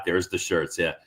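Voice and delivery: ordinary talk, nothing marked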